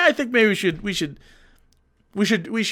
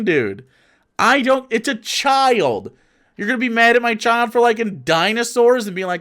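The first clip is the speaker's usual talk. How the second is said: ordinary talk, nothing marked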